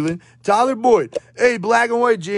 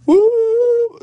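excited woo; 7 dB louder than usual. Real, not acted. Excited cheer after a pull.

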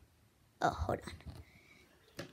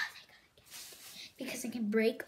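whisper; 9 dB quieter than usual. Acted. Whispered relief about the spinner.

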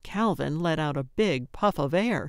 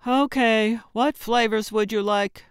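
higher, even-toned drive-through voice; emotionless order-taker; 4 semitones higher, 4 dB louder than usual. A narrator's reading. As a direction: ask it by rote, no feeling. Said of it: "asked with an admirable lack of emotion".

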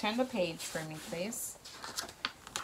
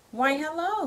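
a higher, bright hello; cheerful greeting; 8 semitones higher, 6 dB louder than usual. Acted. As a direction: greet her gladly. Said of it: "the prince said cheerfully".

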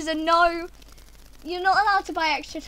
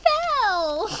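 dismayed wail; 9 semitones higher, 5 dB louder, slower than usual. Real, not acted. Dismay after falling.

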